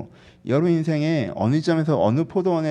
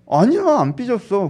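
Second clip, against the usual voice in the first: mock huffy denial, higher and drawn out; sulky denial; 7 semitones higher, 3 dB louder than usual. A sulking friend denying being upset, huffy. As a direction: slowly insist as a sulking friend would. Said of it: "삐졌다고 하면서 그런 거잖아요".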